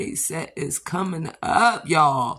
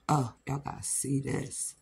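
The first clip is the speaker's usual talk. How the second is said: ordinary talk, nothing marked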